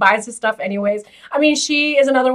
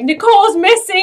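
mock alarm; 10 semitones higher, 7 dB louder than usual. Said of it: "And he's like".

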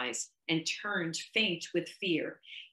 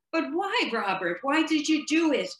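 higher, louder disbelieving why; shocked question; 8 semitones higher, 5 dB louder than usual. A narrator's reading. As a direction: voice leaps up in shock at what he did. Nurse shocked by his act.

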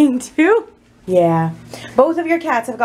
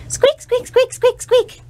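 high playful squeaking; guinea pig squeaks; 12 semitones higher, slower than usual. Pretend. Vlogger squeaks at guinea pigs in mock squeaks.

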